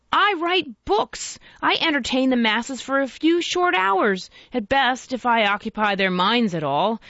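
lightly hammed-up grand oratory, starting high; orator's voice. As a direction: declaim like a mock orator to the masses. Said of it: "he cried in his best orator's voice".

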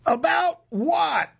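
high, drawn-out shout; yelling back. Acted. Narrator answers the woman over blaring club music, yelling. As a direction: yell each word to carry over music.

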